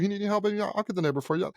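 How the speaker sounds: high, soft doting mother; imitating Southern mom. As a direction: coo an offer like a doting mom. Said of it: "She'd be like".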